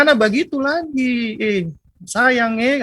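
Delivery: high, lilting eastern Indonesian scolding; expressive eastern accent. Acted. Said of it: "orang-orang sana ekspresif". (omelan sayang berlogat timur, nada tinggi)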